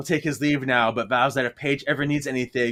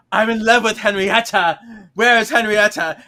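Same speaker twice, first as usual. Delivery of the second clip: loud, higher swooning pirate cry; lovesick pirate ghost; 7 semitones higher, 6 dB louder than usual. Retold or imitated. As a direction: call out for a lost love.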